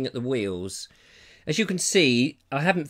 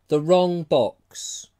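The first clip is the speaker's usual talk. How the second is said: slow emphatic title; 2 semitones higher, 3 dB louder, much slower than usual. Real, not acted. Naming a film title.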